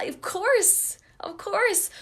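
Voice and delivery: high, scoffing "of course, of course"; mock duh reaction. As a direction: toss it off like it's obvious. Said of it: "I was like".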